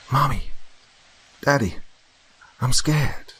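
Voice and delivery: put-on high, small frightened child's voice; a child calling from the dark, frightened; scared child. A narrator's reading. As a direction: call out like a lost scared little girl.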